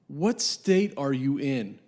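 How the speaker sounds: drawn-out, incredulous question; exasperated slow question. Retold or imitated. Caller's buddy asks the dealer where he is, exasperated and very slow. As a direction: stretch each word out, as if baffled.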